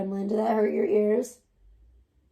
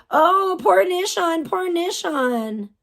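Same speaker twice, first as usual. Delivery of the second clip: drawn-out, higher-pitched mock sympathy; mock pity; 8 semitones higher, slower than usual. Mimicking a viewer fussing over Nishon, mock pity. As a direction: coo it slowly in exaggerated pity. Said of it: "don't sit here and go".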